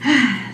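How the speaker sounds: a heavy caught-out sigh; long guilty sigh. Retold or imitated. Married date answering her question with a sigh. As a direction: sigh like you've been caught. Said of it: "And he goes".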